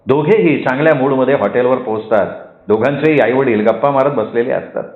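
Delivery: ordinary delivery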